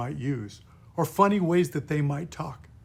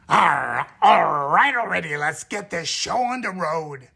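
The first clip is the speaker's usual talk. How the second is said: louder, throaty pirate grumble; pirate growl; 3 semitones higher, 7 dB louder than usual. Shown as a demonstration. Pirate bad guy telling everyone to get going, gruff growl. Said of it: "the five core sounds".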